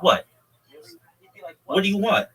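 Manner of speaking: high, loud defensive snap; defensive what. Retold or imitated. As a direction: push back, annoyed. Mashiach gets defensive.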